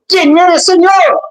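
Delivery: startled cry. Acted. Saulo cries out to Jesus.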